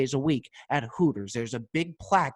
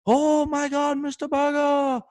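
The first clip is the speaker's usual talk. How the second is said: much higher, louder ecstatic welcome; overjoyed shop owner; 13 semitones higher, 4 dB louder than usual. Retold or imitated. Liquor store owner greeting Berger in front of his date, ecstatic and high. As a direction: overdo the delight at a regular. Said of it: "he acts like he sees me every single day".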